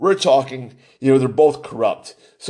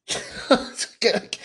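scoffing laugh; 7 semitones higher, 5 dB quieter than usual. Real, not acted. Scoffing at the question.

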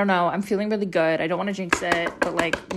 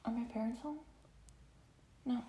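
much softer worried aside; hushed aside; 3 semitones higher, 18 dB quieter, faster than usual. Real, not acted. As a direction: ask quietly so no one hears. Checking if parents home.

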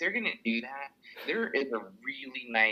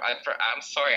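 ordinary talk

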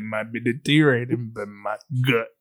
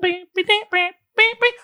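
high-pitched beeps. Pretend. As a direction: imitate radio beeps, high. Radio beeps imitated.

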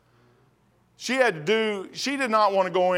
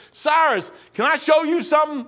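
calling out to the king; 6 semitones higher than usual. Retold or imitated. Cupbearer calls out to King Cyrus, raised voice. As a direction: call the king over eagerly.

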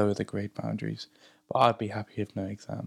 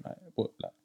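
whispering; 7 semitones higher, 12 dB quieter than usual. Real, not acted. Unvoiced aside mid-sentence.

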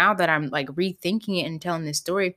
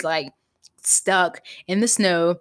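ordinary delivery

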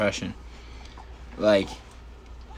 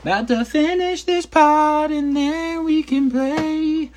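high and slow, cooing to the puppy; 3 dB louder, much slower than usual. Real, not acted. Owner promises his puppy playtime, high baby talk. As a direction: sing it up high to the dog.

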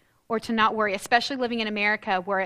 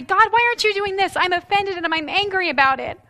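complaining prayer; 7 semitones higher, 3 dB louder than usual. Pretend. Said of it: "I could look at that and say".

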